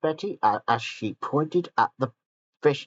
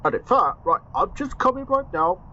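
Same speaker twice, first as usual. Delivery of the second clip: excited Gordon; 10 semitones higher than usual. Acted. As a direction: squeal it out with delight. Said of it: "said Gordon".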